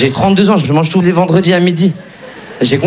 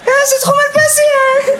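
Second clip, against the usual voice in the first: very high squeaky falsetto; mock customer in a tiny falsetto; squeaky complaining guy. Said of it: "T'as déjà vu un mec".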